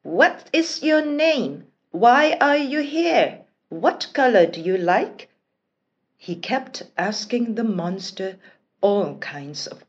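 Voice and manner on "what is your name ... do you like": eager prince. Acted. Prince pestering monster with questions.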